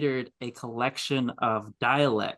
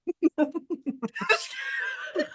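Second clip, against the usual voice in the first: high cackle with a cry; cackling laugh. Real, not acted. Reacting to the South joke, cackling.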